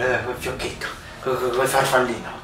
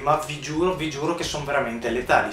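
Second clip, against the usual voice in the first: ordinary talk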